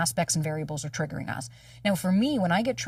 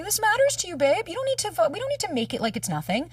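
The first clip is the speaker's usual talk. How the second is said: much higher, earnest self pep talk; higher earnest self-talk to herself; self-soothing pep talk; 11 semitones higher than usual. Retold or imitated. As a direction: lift yourself up to act. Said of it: "self-soothe myself and say".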